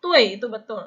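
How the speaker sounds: tui said loudly with a strong puff on the T; loud aspirated tui. Shown as a demonstration. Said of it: "tui itu ada T-nya". (tui keras dengan angin yang kuat)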